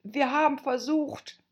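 high, meek explanation; very humble. Acted. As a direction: explain meekly to the queen. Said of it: "sagte Zwei sehr demütig".

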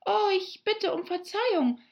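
high, startled plea for pardon; anxious apology. A narrator's reading. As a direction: blurt an apology, afraid of having hurt someone. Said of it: "rief Alice schnell, aus Angst". (erschrockene, hohe Entschuldigung)

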